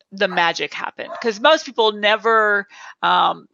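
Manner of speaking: ordinary talk, nothing marked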